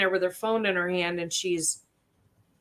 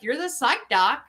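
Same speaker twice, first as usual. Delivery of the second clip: imitating Joe; 6 semitones higher than usual. Therapist imitates Doctor Joe introducing her to the lieutenant. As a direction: put on a lightly voiced Joe, blunt and cheerful. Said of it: "And he's just like".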